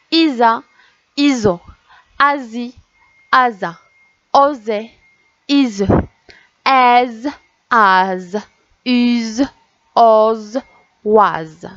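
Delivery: isolated syllables, each one distinct with a pause; syllable drill. Shown as a demonstration. Teacher reading syllables to children, measured and separated. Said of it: "Je vais vous relire les syllabes".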